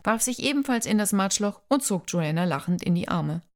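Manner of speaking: usual speaking voice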